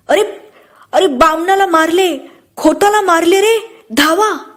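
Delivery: put-on old woman's cry. A narrator's reading. Old woman alerting villagers that a man is attacked, crying out. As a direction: raise the alarm in a high, stretched call. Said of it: "म्हातारीने ओरड केली".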